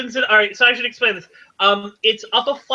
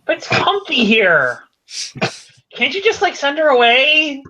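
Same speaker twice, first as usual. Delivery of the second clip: pouty whine; 3 semitones higher than usual. Acted. Lena would rather stay in, pouting and whining. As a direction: pout and wheedle to get out of it. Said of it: "Lena pouts and whines".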